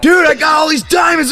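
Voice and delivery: high, loud hammed-up yell; tripping shout. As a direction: yell it like you've struck it rich. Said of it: "tripping, shouting about diamonds".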